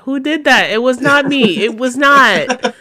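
high, loud, frantic denial; panicky Zenitsu squeal. Retold or imitated. Friend mocking Zenitsu's panicked denial, high frantic squeal. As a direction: squeal it out like a cowardly hero caught. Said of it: "He's like".

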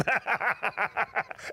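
breathy laugh bursting out; real burst of laughter. Real, not acted. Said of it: "I just busted out laughing".

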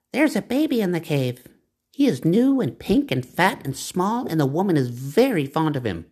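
high, small bat voice; little bat, lightly voiced, high. A narrator's reading. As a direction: squeak out the news.

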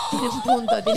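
loud laugh, then the words laughed out; burst of laughter. Real, not acted. Co-host laughing hard at the guest's rant. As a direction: crack up at the guest.

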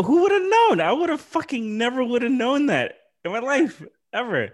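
can't believe what he just learned; excited amazement. Real, not acted. Amazed at the coincidence.

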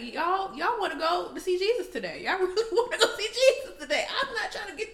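high, loud, laughing threat; sassy warning. Retold or imitated. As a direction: warn them, laughing. Retold warning to shoppers.

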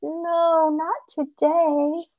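small, high rabbit voice; pleading rabbit. Acted. Caught rabbit begs coyote off, small pleading voice.

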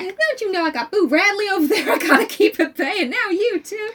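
high, loud put-upon rant; high-pitched, hammed-up exasperation; mock indignation. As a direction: play an exasperated kid scolding an uncle. Playing Scout ranting at Uncle Jack.

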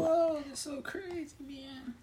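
very high falsetto quip; slow, squeaky mock amazement; playful aside. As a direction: tease in a drawn-out falsetto. Son's playful aside as mom introduces him.

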